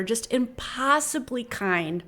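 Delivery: ordinary talk, nothing marked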